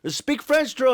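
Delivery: high, loud demand; imitating Greedo. Greedo telling the boxer to speak French, high loud demand. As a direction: bark the order as Greedo. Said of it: "Greedo was like".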